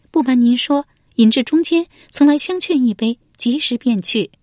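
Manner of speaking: high, put-on female voice; high-pitched wife giving her account; voiced noblewoman explaining.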